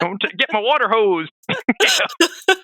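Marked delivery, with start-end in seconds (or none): 0.0-1.3 put-on grumpy old man. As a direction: shake your fist at the kids on your lawn. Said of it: "He's like".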